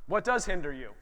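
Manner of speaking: normal talking voice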